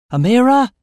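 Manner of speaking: loud, high-pitched call. A narrator's reading. Narrator calls to Amira in the square, calling out. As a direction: shout her name across a distance. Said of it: "I called as I approached".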